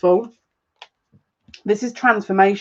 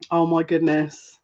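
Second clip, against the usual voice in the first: usual speaking voice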